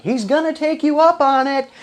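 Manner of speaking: high-pitched, loud mock wife voice; imitated background yell. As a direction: call out like a wife from the next room. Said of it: "his wife yells in the background".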